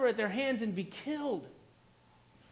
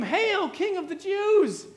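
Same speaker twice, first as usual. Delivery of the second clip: higher-pitched mock acclamation; mocking hail; 7 semitones higher, 3 dB louder than usual. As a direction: lightly imitate jeerers hailing a king. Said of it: "and mocked him".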